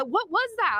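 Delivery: high, startled "what was that"; stunned question. Retold or imitated. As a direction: ask it as if struck by something unseen.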